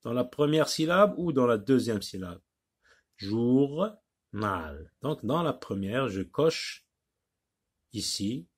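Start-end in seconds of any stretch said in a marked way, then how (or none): 3.2-4.9 "Journal" as jour, pause, nal; split into syllables. Shown as a demonstration. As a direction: leave a clear gap inside "Journal". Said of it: "Deux syllabes".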